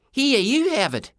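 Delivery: put-on high-pitched kid; high boyish urgency; alarmed boy. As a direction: offload it hastily in a high voice. Boy hands over spooky hat.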